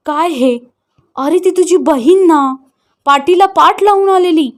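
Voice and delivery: mildly pleading, a touch of tears; crying mother. Acted. Mother pleading with her son over his sister, tearful. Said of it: "आई रडत म्हणाली".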